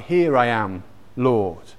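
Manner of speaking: ordinary talk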